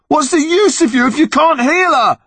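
high furious yelling; angry shout. Acted. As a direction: scream it at the monster, furious. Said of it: "pounding away".

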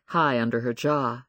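plain reading voice

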